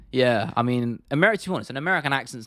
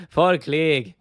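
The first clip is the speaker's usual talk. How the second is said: louder put-on Scottish accent; 3 semitones higher, 5 dB louder than usual. Shown as a demonstration. Streamer trying a requested fighter impression, punchy Scottish accent. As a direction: belt out the name in mock Scottish. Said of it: "You just say Paul Craig in a Scottish accent".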